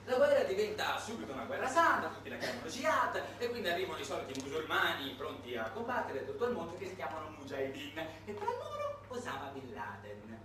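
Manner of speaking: normal talking voice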